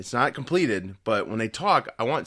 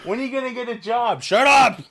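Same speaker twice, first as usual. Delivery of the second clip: high shouting mom; yelling across the room; 10 semitones higher, 3 dB louder than usual. Pretend. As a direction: nag loudly from another room.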